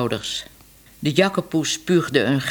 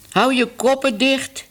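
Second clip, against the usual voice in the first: furious shout; 10 semitones higher than usual. A narrator's reading. Mother cat to her squeaking kittens, furious shout. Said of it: "riep de moeder woest".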